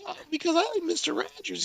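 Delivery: very high, put-on child's voice; little kid voice. Prank caller posing as a child donor, little kid voice. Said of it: "love a little kid voice".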